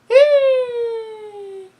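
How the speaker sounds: loud, very high gleeful squeal. Real, not acted. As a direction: let out a giddy shriek. Squealing at the scene.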